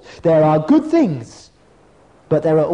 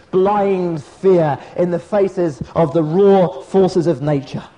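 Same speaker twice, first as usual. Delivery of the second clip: usual speaking voice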